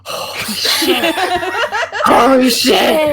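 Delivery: high, drawn-out cry through laughter; laughing outburst. Real, not acted. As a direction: shriek it slowly while cracking up. Players crack up at the reveal.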